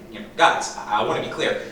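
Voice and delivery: ordinary talk, nothing marked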